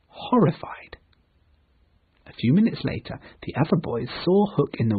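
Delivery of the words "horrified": horrified whisper; hushed dramatic reading. A narrator's reading. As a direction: breathe the word in shock. Narrator dramatizes Peter's shock.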